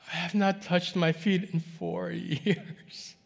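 slow, breathy whisper with a catching laugh; tearful whisper. Retold or imitated. Steve overwhelmed telling the pastor, tearful whisper. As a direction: speak through tears, barely voiced. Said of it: "He's got tears in his eyes".

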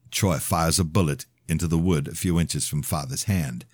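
plain reading voice

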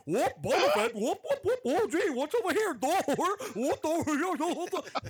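high, loud goofy bumbling voice with laughs; hammed-up dopey hero yelping in surprise; bumbling Han Solo. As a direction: play Han as a clueless clown, whooping. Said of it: "is just".

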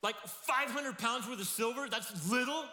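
high, loud disbelief; can't believe what you heard; incredulous listener. Retold or imitated. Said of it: "You're like".

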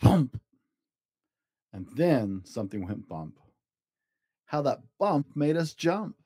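lightly read-aloud picture book narration; story narration. A narrator's reading. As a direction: read it to a child, rhythmic and even.

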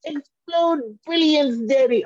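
put-on high, slow little boy voice chanting the motto; child's voice. Dad imitating his son chanting the motto in a high, slow voice. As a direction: play the small son proudly chanting back to dad.

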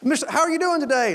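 doctor's greeting. Retold or imitated. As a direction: check on a patient with a lifted voice. Voices doctor greeting the patient.

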